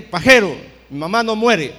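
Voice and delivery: loud, defiant yelled retort; furious shout. Retold or imitated. Son yells at the prophet again, defiant shout. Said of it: "le volví a gritar".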